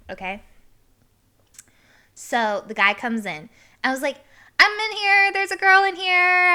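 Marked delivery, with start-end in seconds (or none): 4.6-6.5 high, loud urgent call; acted alarmed warning. Woman warning a guy coming in, alarmed shout. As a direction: call out a re-enacted warning, pitched way up. Warns man entering the stall.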